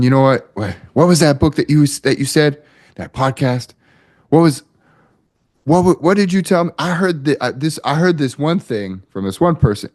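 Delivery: excitedly trying to remember your tip; eager friend. Retold or imitated. Friend eagerly recalls advice.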